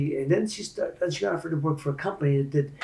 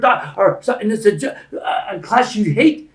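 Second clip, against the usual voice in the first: loud, high, driving speech; motivational preaching; 7 semitones higher, 9 dB louder than usual. Real, not acted. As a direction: preach it to a crowd at full force.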